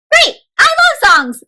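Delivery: high, delighted robot voice; excited yellow robot. Acted. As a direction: squeal with joy over songs. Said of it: "says yellow robot".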